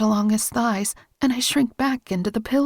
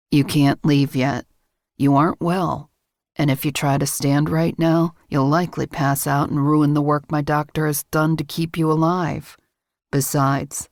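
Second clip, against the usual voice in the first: lowered male voice, firm and commanding; low firm man; 8 semitones lower than usual. A narrator's reading. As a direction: voice him low, keeping her in bed. Man stops her leaving.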